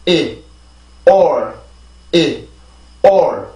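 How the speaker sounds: 이 then a stronger r-coloured "or" for "all"; evenly spaced falling syllables, 이 and "or" in turn; rhythm drill. Shown as a demonstration. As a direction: alternate short 이 and "all" pronounced as "or". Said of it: "리듬을 만들죠".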